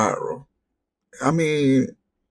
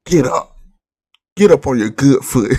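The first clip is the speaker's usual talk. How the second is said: barely put-on rapper hype chant. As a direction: lightly hype it up like a rapper shouting at a show. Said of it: "I'ma say this in my [name] voice".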